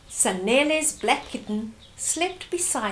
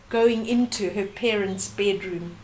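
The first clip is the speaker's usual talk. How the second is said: ordinary delivery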